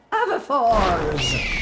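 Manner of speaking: slow, drawn-out casting call; spell incantation. Acted. Professor demonstrating the spell to students, slow incantation. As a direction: cast it for the class to see.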